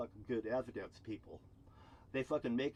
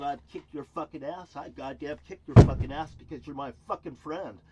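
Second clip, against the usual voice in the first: louder, furious burst of anger at him; angry shout; 3 semitones higher, 13 dB louder than usual. Real, not acted. Ranting vlogger shouts his threat at a former friend in a real rage. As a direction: yell it at the man who wronged you.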